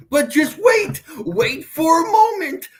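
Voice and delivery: laughing yell; shout. Real, not acted.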